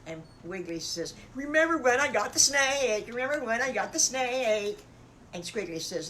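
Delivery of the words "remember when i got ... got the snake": much higher and louder, bragging; gleeful piglet. Acted. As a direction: crow it like a proud little pig. Piglet boasting, voiced loud.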